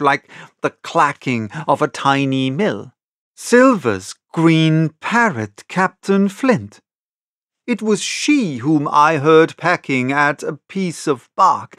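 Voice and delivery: ordinary delivery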